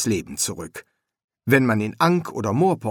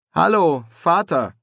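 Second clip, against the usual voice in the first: louder, raised greeting; calling out a greeting; 7 semitones higher, 5 dB louder than usual. Acted. Son greeting his dead father, calling out. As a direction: call it out higher to someone across the room.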